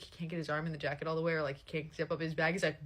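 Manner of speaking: normal talking voice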